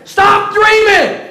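half-joking protective parent begging; clingy parent. Retold or imitated. Pastor imitating what he'd now say to his children, stay here. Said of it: "In retrospect, I would say".

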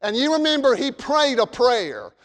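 ordinary delivery

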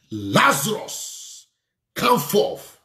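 preacher acting a summons to the dead; Jesus' commanding call. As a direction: draw out the name, then lift the command. Acting Jesus calling Lazarus.

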